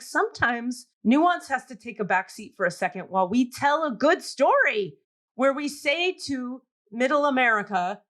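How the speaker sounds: ordinary talk, nothing marked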